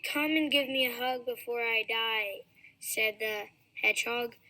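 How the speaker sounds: ordinary delivery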